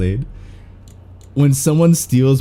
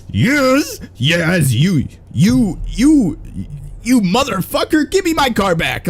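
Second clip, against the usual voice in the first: high-pitched mock yell at the rival; mock screaming; 7 semitones higher, slower than usual. Gamer yelling at the rival who stole his car, mock screaming. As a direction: shriek it, half joking. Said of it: "like, screaming".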